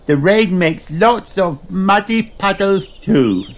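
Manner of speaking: slow, high piglet voice; eager child, drawn out and high; Peppa. A narrator's reading. Peppa greets Rebecca Rabbit, high-voiced. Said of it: "says Peppa".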